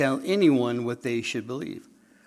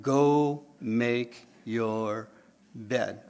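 mock studious reading; 3 semitones lower, much slower than usual. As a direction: Go make your bed stretched out very slowly. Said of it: "study that phrase".

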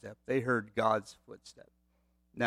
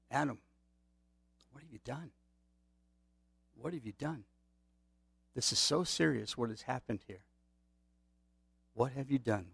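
much softer, grieving plea; sorrowful pleading; 5 dB quieter than usual. Retold or imitated. God calling hiding Adam, soft sorrowful plea. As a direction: plead softly, heartbroken rather than angry. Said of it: "I think it was a sorrowful voice".

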